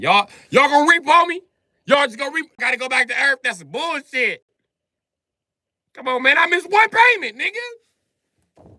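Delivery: high, loud pleading outrage; indignant protest. Acted. Leo protesting eviction over one missed payment, high loud outrage. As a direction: protest being evicted from heaven.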